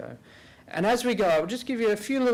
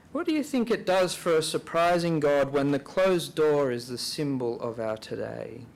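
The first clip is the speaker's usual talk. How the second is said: guided reflection; 3 semitones lower than usual. Real, not acted. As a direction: pose it gently for silent prayer, softening as you go. Leads silent reflection with questions.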